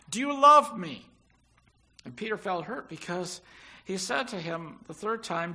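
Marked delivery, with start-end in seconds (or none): 0.1-1.1 pleading question. Acted. Jesus asks Peter the third time.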